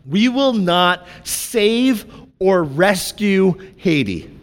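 slow, deliberate, every word weighted; blunt emphasis. Real, not acted. Pastor bluntly telling the church a hard truth, slow emphasis. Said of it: "I'm gonna say this very bluntly".

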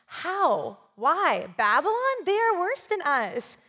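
high, pleading what-are-you-doing; shocked disbelief. Retold or imitated. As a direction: act out his stunned protest. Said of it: "He's like".

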